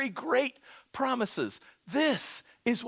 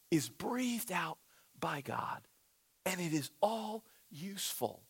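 hushed; 3 semitones lower, 10 dB quieter than usual. Real, not acted. Hushed emphasis in sermon.

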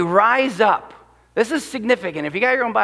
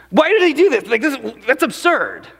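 scornful disbelief; 4 semitones higher than usual. Acted. Voicing the psalm's derision.

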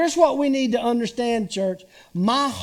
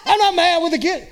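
defensive denial; 7 semitones higher, faster than usual. Retold or imitated. Husband denying to his wife he's mad, rising and laughing. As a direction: snap back that you're not mad. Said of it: "it sounded like you're mad".

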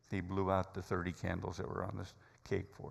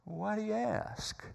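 slow, wary question, pitched up high; suspicious; 12 semitones higher, slower than usual. Retold or imitated. Counter guy wary of the stranger, asking warily. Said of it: "And then sort of suspiciously".

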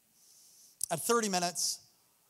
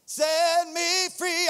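exultant cry; 8 semitones higher, 4 dB louder, much slower than usual. Real, not acted.

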